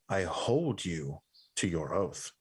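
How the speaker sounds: slow, low oath-binding voice; grave, measured pronouncement; solemn figure. Acted. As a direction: intone it low and slowly. Voicing a silent figure's solemn message.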